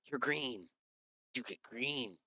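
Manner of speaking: soft, awed little scientist voice; amazed, under the breath; hushed wonder. Acted. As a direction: marvel quietly, just after a gasp. Scientist gasps at green visitor.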